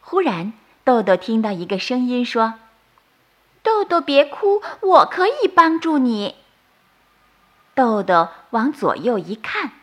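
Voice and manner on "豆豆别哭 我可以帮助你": high, light little fairy; a tiny kind sprite offering help. Acted. Leaf fairy comforting crying Doudou, high and gentle.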